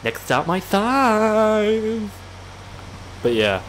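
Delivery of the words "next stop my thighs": high train whistle into a long drawn-out call; mock train call. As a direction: play the train, stretching the last word. Mimics a train announcing its stop.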